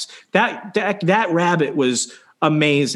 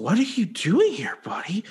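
hushed, high, careful question; wary whisper; 6 semitones higher, 4 dB quieter than usual. Retold or imitated. Waking up to the escaped rabbit, wary whisper. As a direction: softly coax an animal you don't quite trust.

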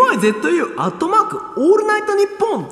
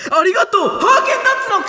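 big segment-opening yell; shouted title call; 5 semitones higher, 3 dB louder, much faster than usual. Pretend. Performer launches the thank-you segment, shouted title call. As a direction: belt out the corner's title.

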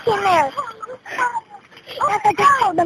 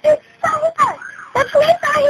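high, tearful urgency; frightened crying out; 7 semitones higher than usual. Real, not acted. Child on emergency call at a house fire, tearful panic. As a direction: cry it out in panic, pitched high.

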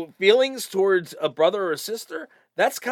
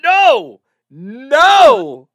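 loud protest; 7 semitones higher, 11 dB louder than usual. Real, not acted. Reacting to twin sister letter.

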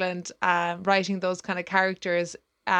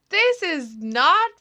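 high, slow, emphatic protest; indignant retort; 7 semitones higher, 4 dB louder, slower than usual. Retold or imitated. Customer objecting to the bookseller's joke, retold indignantly. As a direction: draw out each word in offended protest. Retold argument over an offensive book.

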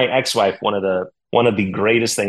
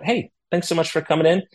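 bright host greeting; 11 semitones higher than usual. Retold or imitated. Greeting angry guests with champagne.

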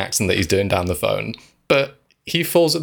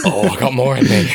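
laughing imitation of the actor; 3 semitones higher, 5 dB louder than usual. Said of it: "he was there".

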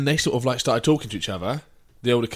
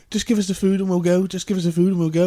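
pitched up, insistent repeat; pleading woman; 6 semitones higher, 3 dB louder than usual. Retold or imitated. Girl demanding her food at the counter, insistent pleading. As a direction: keep begging for the food. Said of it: "she was like".

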